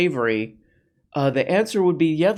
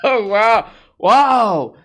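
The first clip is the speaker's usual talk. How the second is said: mock-amazed wow, high and loud; sarcastic wow; 7 semitones higher, 7 dB louder, much slower than usual. Commentator mocking the show's guest list, sarcastic loud wow. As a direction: gush with fake amazement.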